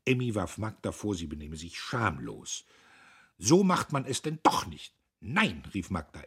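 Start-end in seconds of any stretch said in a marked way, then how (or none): 3.4-5.6 loud, high, cutting retort; sharp. A narrator's reading. Sisters squabble over the guest, sharp-voiced. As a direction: quarrel sharply in a raised voice. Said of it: "mit scharfen Stimmen sprechen".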